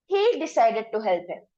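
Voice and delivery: ordinary delivery, nothing marked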